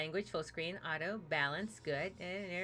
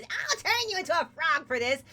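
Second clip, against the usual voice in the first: high, louder witchy threat; threatening hag; 7 semitones higher, 4 dB louder than usual. Acted. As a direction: curse her like a storybook witch. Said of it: "and says".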